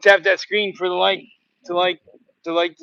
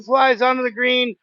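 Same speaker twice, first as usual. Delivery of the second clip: usual speaking voice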